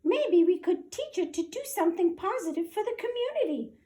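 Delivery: high mouse officer voice; officer proposing an idea, squeaky; squeaky suggestion. Acted. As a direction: offer it hopefully in a tiny voice. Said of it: "suggested the Big Cheese".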